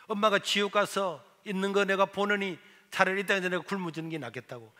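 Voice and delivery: usual speaking voice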